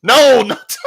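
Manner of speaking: high startling yell; sudden scare shout. Pretend. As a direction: jump out and yell to spook them. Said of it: "don't scare me like that".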